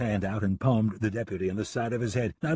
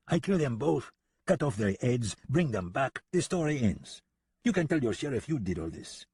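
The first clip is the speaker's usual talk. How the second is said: put-on higher, French-accented casual killer; nonchalant French gunman; 4 semitones higher than usual. French gunman offers deputy the credit, nonchalant French accent. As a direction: list the killing plainly in a French accent.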